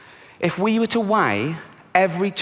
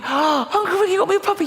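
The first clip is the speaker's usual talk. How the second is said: put-on high squeaky delighted little girl; thrilled child. Niece asking her uncle, high-pitched disbelieving joy. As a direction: gasp it out like a child on Christmas.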